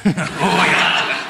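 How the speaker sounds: slow, exaggerated macho growl; put-on manly voice. Frightening him with the line, slow put-on man voice. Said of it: "it's a voice you must do throughout".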